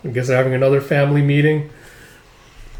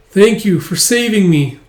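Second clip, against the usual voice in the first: high, slow, tearful thank-you; mock-tearful girl thanking her rescuer; 8 semitones higher, 5 dB louder than usual. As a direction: voice her gratitude through tears, raised. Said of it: "And as she's crying".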